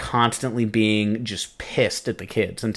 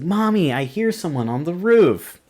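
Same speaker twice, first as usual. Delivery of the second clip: put-on scared little girl; 7 semitones higher than usual. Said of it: "she's like".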